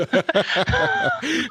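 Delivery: a sudden squeal of a laugh; high burst of laughter. Real, not acted. Laugh after shower joke. (éclat de rire aigu)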